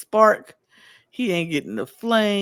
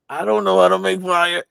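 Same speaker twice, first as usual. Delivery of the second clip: louder, mock-panicked admission; inner panic; 5 dB louder than usual. Sam panicking in his head over fire, louder inner panic. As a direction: blurt out the secret worry. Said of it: "in his head, he's like".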